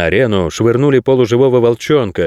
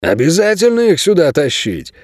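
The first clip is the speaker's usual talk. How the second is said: higher, challenging question; defiant challenge; 7 semitones higher than usual. A narrator's reading. A guard objects to the newcomers, defiant. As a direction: throw the question out as a challenge, pitch raised. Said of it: "пропустил мимо ушей вызывающий тон".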